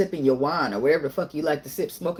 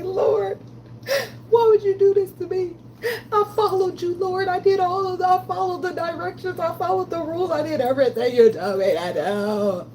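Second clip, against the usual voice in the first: crying prayer; 12 semitones higher than usual. Retold or imitated. Reliving crying to the Lord, high tearful plea. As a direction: cry out to God as if it still hurts. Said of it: "But I used to say".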